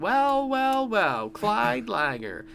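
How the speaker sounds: high, slow, smug well well well; drawn-out gloating headmaster voice. Retold or imitated. Voicing headmaster mocking Clyde.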